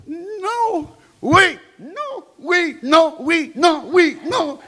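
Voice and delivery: very high-pitched back-and-forth contradiction; volley the words in a squeaky register; childish bickering. Acted. Student and headmaster contradict each other, bickering. Acted bickering in French and English.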